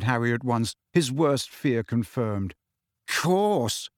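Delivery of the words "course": understated bitterness, pitched up; one sour, higher-pitched word; bitter. A narrator's reading. Ron on the Slytherin prefect news, bitterly. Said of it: "said Ron bitterly".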